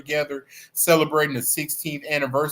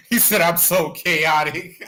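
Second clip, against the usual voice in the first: laughing; 3 semitones higher than usual. Real, not acted. Streamer amused by a viewer's comment, laughing. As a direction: read it out through laughter.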